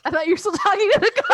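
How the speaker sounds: high laughing fit. Real, not acted. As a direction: laugh through the words. Laughing fit after cat talk.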